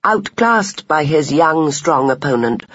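plain reading voice

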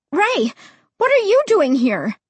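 high, startled exclamation. A narrator's reading. Narrator as child finding Ray behind them, startled. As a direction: blurt it out high in surprise. Startled by pursuer on dark street.

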